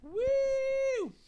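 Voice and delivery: high excited whoop; cheering woo. Real, not acted. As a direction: whoop to open the show. Show opening cheer.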